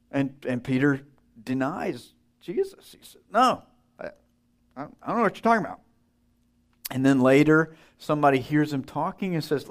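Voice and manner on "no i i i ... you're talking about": higher, rushed and flustered; panicked denial. Retold or imitated. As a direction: blurt the denial fast to get away.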